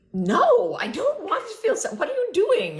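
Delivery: high, squealing mock protest, half laughing; retold whiny protest. Speaker replays arguing with the guide in her head, high whiny protest.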